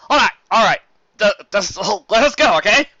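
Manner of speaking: nervous laugh. Acted. Flam caves to Carrot Top, flustered.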